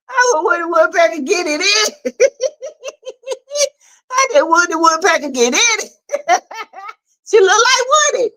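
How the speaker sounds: can barely talk for laughing; laughing hard. Real, not acted. Fan cracking up at a scene, laughing through words.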